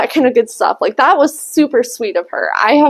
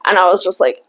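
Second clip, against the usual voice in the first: usual speaking voice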